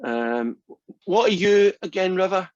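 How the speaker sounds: ordinary delivery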